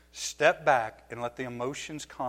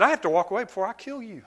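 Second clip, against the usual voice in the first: laughing mock threat; 8 semitones higher, faster than usual. Retold heated warning to daughter.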